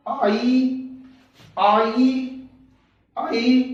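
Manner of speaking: आई repeated slowly, each a clear 'aa-ee' glide; sound drill. Shown as a demonstration. Teacher drilling children on the आई sound, repeated clearly. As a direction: drill आई three times, each one distinct. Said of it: "ई मतलब बड़ी ई".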